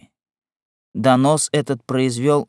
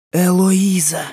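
high, startled exclamation; crying out; 6 semitones higher, 4 dB louder, slower than usual. A narrator's reading. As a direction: cry out a daughter's name in shock. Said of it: "вскричала Тизон". (вскрик матери)

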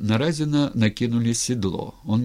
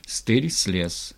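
ordinary delivery, nothing marked

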